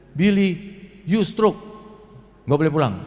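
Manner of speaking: emphatic retold doctor's order; doctor's pronouncement. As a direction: deliver it as the doctor's forceful verdict. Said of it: "dokter begitu lihat hasilnya".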